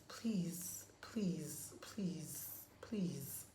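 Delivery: pleading, dropping to a whisper; fading whispered pleas. Real, not acted. Pleading with parents to guard their daughters, whispered. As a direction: beg softly, fading out each please.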